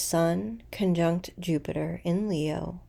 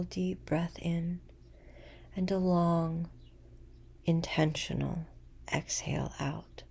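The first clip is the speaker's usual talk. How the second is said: guided meditation; 4 dB quieter than usual. Acted. Guiding listeners into a full moon breathing practice, meditative.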